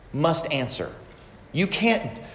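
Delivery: usual speaking voice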